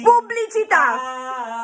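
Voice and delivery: drawn-out announcement. Acted. Cutting him off, slow announcement.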